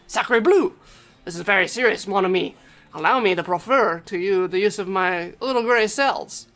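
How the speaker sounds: put-on grand French-accented sleuth; French detective. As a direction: proclaim gravely in a theatrical French accent. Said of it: "a portly man with a dapper gray suit".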